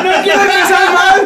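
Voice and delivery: high, loud laughing repeat; laughing through words. Real, not acted. Host echoes the joke to Pastor, laughing. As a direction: crack up while echoing the punchline.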